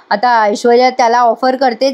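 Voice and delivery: ordinary delivery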